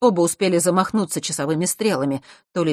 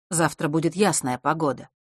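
ordinary delivery, nothing marked

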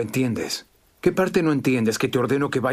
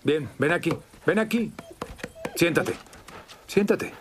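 higher, sing-song call to a dog; coaxing a dog; 5 semitones higher, 4 dB quieter than usual. Real, not acted. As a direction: coax the dog over gently. Sergeant coaxes dog to him. (llamando al perro con cariño)

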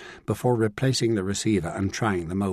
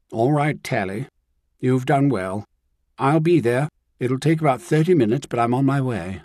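firm, louder, a touch of comfort; firm and authoritative; 4 semitones higher, 4 dB louder than usual. A narrator's reading. Woman reassuring the shaken housekeeper on the phone, firm. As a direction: take charge and reassure. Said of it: "Caroline's voice had become firm, authoritative, and strangely comforting".

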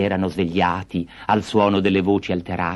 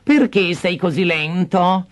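high, slow scolding question; impatient stepmother; 10 semitones higher, slower than usual. Acted. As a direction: nag him.

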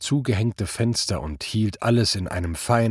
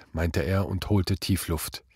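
usual speaking voice

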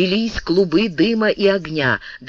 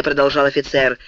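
ordinary delivery

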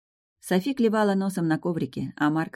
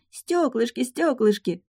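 put-on higher-pitched excited child shriek; hysterical child cry; 8 semitones higher, slower than usual. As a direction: recall a child shrieking with joy. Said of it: "истерически кричала".

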